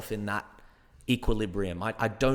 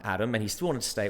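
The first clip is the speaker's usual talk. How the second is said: usual speaking voice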